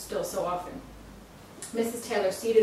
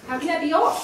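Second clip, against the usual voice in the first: higher, louder, disbelieving; upset protest; 8 semitones higher, 8 dB louder than usual. Acted. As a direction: push back hard. Emily protests to the psychic.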